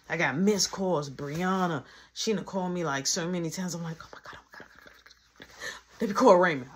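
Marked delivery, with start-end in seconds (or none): 4.0-6.0 breathless whispered oh my God; panicked whisper. Retold or imitated. Seeing all the missed calls, whispering in panic.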